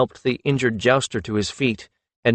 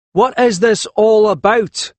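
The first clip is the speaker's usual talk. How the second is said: much higher and louder, slow, appalled; aghast; 11 semitones higher, 7 dB louder than usual. A narrator's reading. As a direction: blurt it out in shock. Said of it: "asked, aghast".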